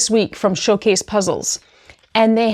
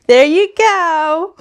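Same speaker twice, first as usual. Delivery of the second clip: high, very slow and louder, doting on the dog; pet praise; 8 semitones higher, 4 dB louder, much slower than usual. Real, not acted. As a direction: stretch it out to reward a pet.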